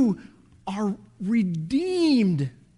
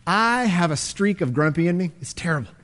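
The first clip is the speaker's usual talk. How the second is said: ordinary talk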